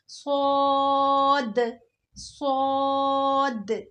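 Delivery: "सौद" said thick and heavy, the final d bouncing back, very slow; heavy swaad with bounce. Shown as a demonstration. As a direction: make "सौद" full and end with a rebound d. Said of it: "लेकिन मोटा पढ़ना है". ("सौद" मोटा, अंत में कलकला)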